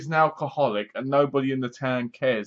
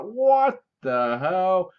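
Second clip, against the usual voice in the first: drawn-out, slow and higher; mock disbelief; 6 semitones higher, much slower than usual. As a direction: stretch the disbelief out. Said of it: "You're like".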